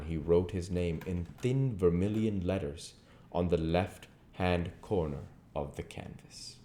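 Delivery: plain reading voice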